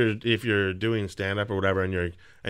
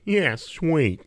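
higher-pitched cartoon dad impression; Peter Griffin; 8 semitones higher, 3 dB louder than usual. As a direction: do a brief, goofy cartoon-dad voice. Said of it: "I can do Peter Griffin".

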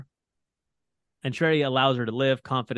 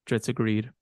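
usual speaking voice

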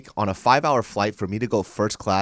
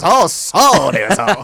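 loud, raised imitation of the co-host; mock show opener; 8 semitones higher, 9 dB louder, slower than usual. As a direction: mimic his abrupt intro, pitched up and loud. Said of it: "He just goes".

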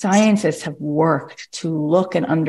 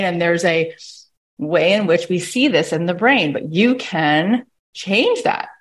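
ordinary talk

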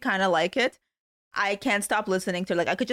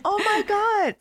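laughing delight; 7 semitones higher than usual. Real, not acted. Laughing at Meredith's speech.

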